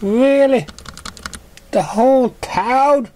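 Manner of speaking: very high, loud and slow wonder; amazed gasp. A narrator's reading. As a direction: gasp it out starry-eyed. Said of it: "Surprised gasp".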